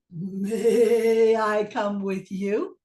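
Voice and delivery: slow, drawn-out bleating plea; lightly voiced goat asking politely. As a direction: stretch it out like a gentle goat. Goat asks to join.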